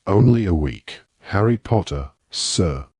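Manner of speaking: plain reading voice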